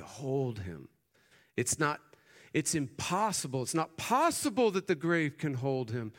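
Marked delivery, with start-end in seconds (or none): 1.6-6.1 preaching at full force. Acted. Preacher builds intensity.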